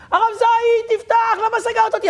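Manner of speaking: high, mock-frantic accusing plea; panicked trapped woman. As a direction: play the trapped neighbor demanding to be let out. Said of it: "היא לחוצה".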